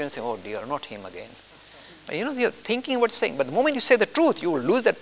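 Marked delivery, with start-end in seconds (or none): 0.2-1.3 a private groan kept to yourself; muttered aside. Retold or imitated. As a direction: lower and softer, said under the breath. Private thought on meeting someone.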